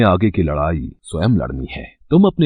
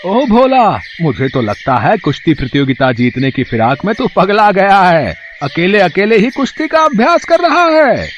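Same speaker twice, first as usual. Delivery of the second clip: high, loud teasing headman calling out; a jeering village chief who thinks the boy has gone mad; high-pitched mocking headman; 12 semitones higher, 3 dB louder than usual.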